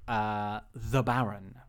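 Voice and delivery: ordinary talk, nothing marked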